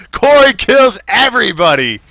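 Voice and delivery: high, loud hype yell; excited shout. Real, not acted. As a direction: scream it at the crowd. Caster hyped over teamfight.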